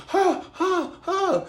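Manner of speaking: very high, strained gasps; hammed-up breathless huffs; mock gasping laugh. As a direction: gasp like his eyes pop. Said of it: "so he was like".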